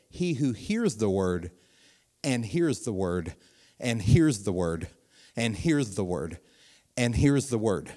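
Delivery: "hears" stressed on each slow repeat. Shown as a demonstration. Preacher showing the word is heard again and again, slow repeated stress on "hears". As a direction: lean on "hears" every time, slowly. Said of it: "Here he says".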